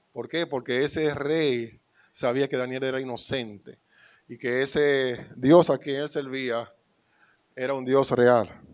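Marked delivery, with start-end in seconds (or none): none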